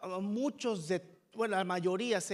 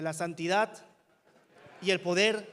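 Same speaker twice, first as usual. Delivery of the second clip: firm title, louder on the second phrase; strong call-and-repeat; 2 semitones lower, 6 dB louder than usual. Real, not acted. Preacher has congregation repeat the message title, strongly. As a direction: lead the congregation in saying it forcefully. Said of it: "dígalo conmigo fuertemente".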